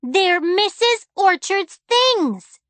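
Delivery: high, loud, slow drawn-out shout; girl shouting at her father, slow and loud. A narrator's reading. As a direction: shout it out, every word stretched. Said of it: "shouted, in fact".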